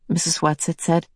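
usual speaking voice

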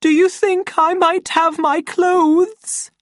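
very high, slow, timid request; frightened voice. A narrator's reading. Lenina asking from behind the bathroom door, high scared voice. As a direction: ask fearfully, calling through a door. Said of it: "came a frightened voice from the bathroom".

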